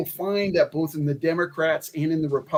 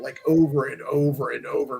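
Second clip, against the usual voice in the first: ordinary delivery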